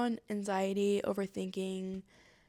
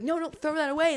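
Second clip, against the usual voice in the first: high, louder mimicked protest; urgent objection; 7 semitones higher, 6 dB louder than usual. Onlookers stopping the speaker binning the food, urgent. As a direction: cut in urgently as a concerned onlooker.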